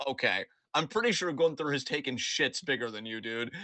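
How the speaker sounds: ordinary delivery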